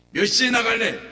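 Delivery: loud, high pointed question; preacher demanding when it will leave; sharp loud demand. Retold or imitated. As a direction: press for an answer.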